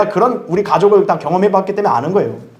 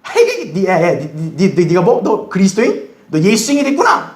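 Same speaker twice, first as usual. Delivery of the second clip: mocking; 2 semitones higher, slower than usual. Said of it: "옛날 친구들이 또 조롱을 하기죠".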